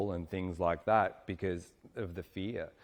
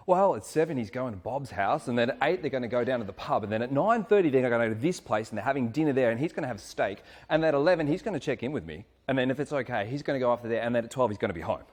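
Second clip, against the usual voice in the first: higher, louder itemised rundown; mock recital of every detail, pitched up; reciting partner's schedule; 4 semitones higher, 5 dB louder, faster than usual. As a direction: rattle off his evening plans in imitation. Mimics woman reciting man's schedule.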